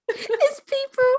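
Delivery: high, squeaky-pitched through laughter; helpless giggling speech; laughing while talking. Real, not acted. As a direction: barely get it out for laughing. Helpless laughter while explaining show.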